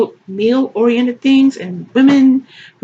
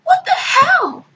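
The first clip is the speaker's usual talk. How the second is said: high, drawn-out disbelief; baffled mock outrage; incredulous outburst; slower than usual. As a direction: stretch it out in high-pitched outrage. Outburst at what was read.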